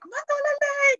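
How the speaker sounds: high, sing-song welcome call; imitating the Tongan ladies' greeting, pitched high; imitated greeting. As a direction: greet her warmly like an auntie calling out.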